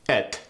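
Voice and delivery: it said as Et, short e vowel; Arabic-accented Et for it. Shown as a demonstration. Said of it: "words like each and it change to".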